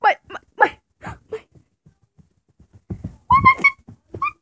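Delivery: very high, frantic squealing; panicked squeak. Acted. Panicking over missing fidgets.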